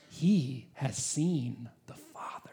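slow unvoiced whisper. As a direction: hush it like a sacred secret. Preacher whispers key truth.

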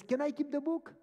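high, loud imitation of the lady; eager request. Woman pleading for book, retold.

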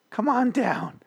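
very high, strangled call; tense-necked pitchman. Shown as a demonstration. Host retries the pitch with neck tense, strained. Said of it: "neck tense".

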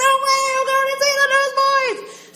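very high, loud squealing shriek; fangirl squeal. Retold or imitated. Teen girl winning concert tickets, squealing fangirl shriek. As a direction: scream it like a thrilled teen fan. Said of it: "fangirl moment".

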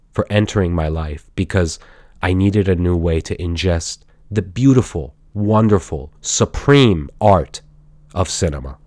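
hushed, reverent love letter, sinking in pitch; tender tribute. Acted. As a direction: pour out gratitude gently, as if to a beloved. Mock love declaration to streaming service.

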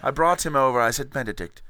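plummy English gentleman [name], hammed-up and pleased with himself; campy British toff accent, laughing through the lines; posh British accent. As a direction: play a pompous Englishman who cracks himself up. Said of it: "the accent is back".

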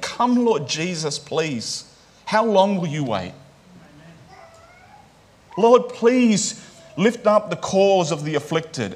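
slow, earnest appeal to God; dependent plea. Acted. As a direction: plead with God, drawing out the please. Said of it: "a call of dependency on God".

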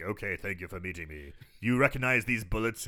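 lightly voiced low, quiet gentleman impression; a hint of a horror-film elder, softly spoken; Christopher Lee. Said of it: "you're doing a good Christopher Lee".

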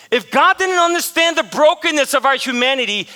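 high, loud sermon voice; forceful, emphatic preacher delivery; impassioned preaching. Real, not acted. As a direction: press it hard on the congregation. Preacher drives home the point.

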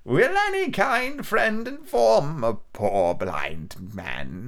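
high, slow, whining blind beggar; old sing-song beggar voice. A narrator's reading. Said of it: "in an old sing-song voice".

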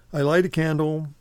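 ordinary talk, nothing marked

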